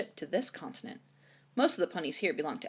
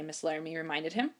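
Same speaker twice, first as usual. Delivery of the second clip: plain reading voice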